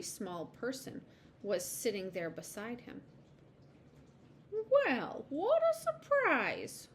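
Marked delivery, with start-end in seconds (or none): 4.7-6.9 high, drawn-out mild surprise; pleasantly surprised squirrel, high-pitched; quiet smile. A narrator's reading. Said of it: "with a quiet smile".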